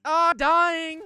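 high, loud, fast panic; panicked yell. Real, not acted. Being attacked in game.

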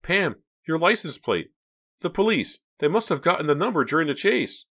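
anxious, pitched well up; sudden alarm. A narrator's reading. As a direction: realize the danger and warn a friend. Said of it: "Then his expression changed".

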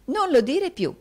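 very high, loud theatrical protest; high-pitched, grand retort; protesting mistress. A narrator's reading. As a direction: forbid the flattery dramatically.

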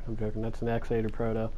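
ordinary talk

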